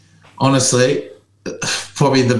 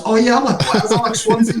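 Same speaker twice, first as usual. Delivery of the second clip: higher, chirpy fake friendliness; mock friendly chat; 7 semitones higher than usual. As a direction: sweeten it up like you're sucking up. Mock chat to win trainer over.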